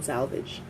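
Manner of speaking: salvage softly as "SAL-vidge"; low, quiet salvage with short i in the ending; correct pronunciation. Shown as a demonstration. Teacher confirming the correct form, salvage softly as "SAL-vidge". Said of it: "that's the correct pronunciation".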